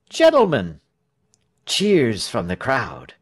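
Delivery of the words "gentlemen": high, loud opening call; oratorical address. A narrator's reading. Tell opening his first speech, oratorical. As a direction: address a crowd grandly.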